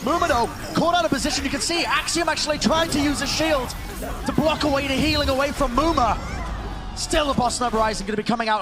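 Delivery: excited play-by-play. Real, not acted. Caster calls a player caught out of position, excited. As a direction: drive through the team fight with mounting urgency.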